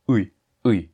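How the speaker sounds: "Oui, oui" said as Russian ы, lips spread in a forced smile; Russian ы sound. Shown as a demonstration. Teacher demonstrating the Russian ы vowel. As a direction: close central unrounded vowel. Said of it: "prononcer le bon son".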